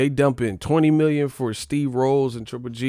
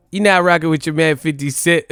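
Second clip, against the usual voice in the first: higher, louder, put-on [name] voice; [name] impression; 5 semitones higher, 8 dB louder than usual. Shown as a demonstration. Showing how a real impression sounds, [name] voice. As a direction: sell it like [name] himself. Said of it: "I wanna actually sound like fifty".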